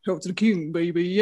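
lightly imitated game-hero catchphrase; Duke Nukem. Fan imitating an oldish voice actor's catchphrase, lightly put-on hero voice. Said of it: "Duke Nukem, yeah, I met him".

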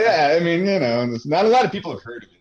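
high, loud, fast mock modesty; sarcastic. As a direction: play it dead-pan ironic. Sarcasm about famous game.

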